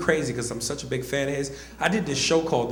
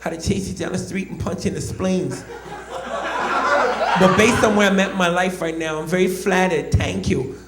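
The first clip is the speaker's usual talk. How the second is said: raised-pitch boxer voice, calmly menacing; polite threat in a high voice; Mike Tyson impression; 4 semitones higher than usual. Boxer answering the comic who imitated him, Tyson voice. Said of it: "he finally said".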